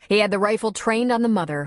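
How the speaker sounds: ordinary delivery, nothing marked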